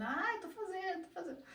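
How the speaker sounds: high, airy mock voice of a student sure she's doing it right; self-deluded student. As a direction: mimic a student fooling herself, high and light. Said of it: "E não tá". (aluna iludida imitada com voz aguda e afetada)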